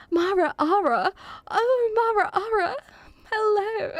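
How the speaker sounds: high, delighted hello with laughter near; excited greeting. Real, not acted. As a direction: coo the friend's name high.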